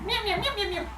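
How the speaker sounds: high mock meowing; cat meow. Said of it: "Squeak at me".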